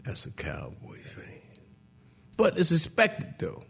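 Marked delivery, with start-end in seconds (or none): 0.0-1.4 a dry whispered verdict; whispered to himself. Retold or imitated. Whispering his verdict on the caller to himself. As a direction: breathe it out under your breath. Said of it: "So then I said to myself".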